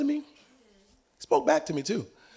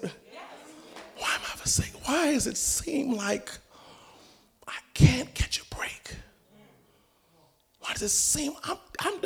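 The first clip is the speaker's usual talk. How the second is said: breathy, much softer whispered cry; hammed-up forsaken whisper; mock despairing whisper; 10 semitones higher, 6 dB quieter, slower than usual.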